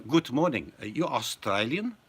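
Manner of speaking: mock German accent. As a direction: fake German accent, drifting Russian. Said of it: "your accent started to turn a bit Russian".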